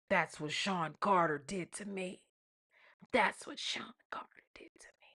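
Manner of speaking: soft mimicry fading to a whisper; imitated quote, sinking into whisper; mock hushed confession. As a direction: repeat it softly, then whisper it. Mimics her claim, fading to whisper.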